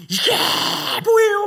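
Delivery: high, loud outburst; angry shout. Retold or imitated. Recalling his anger at God, loud shout. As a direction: fling the question at God in rage. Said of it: "분노에 막 차갖고".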